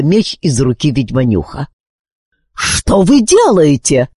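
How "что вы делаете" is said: high, drawn-out disbelief; stunned, pitched-up protest; astonished. A narrator's reading. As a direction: question it in amazement. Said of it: "поражённо уставился на неё ведьманюх".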